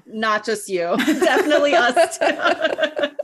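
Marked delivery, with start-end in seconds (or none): 1.0-3.1 giggling through words; laughing. Real, not acted. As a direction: laugh while agreeing. Laughing agreement.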